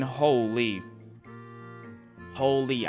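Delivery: normal talking voice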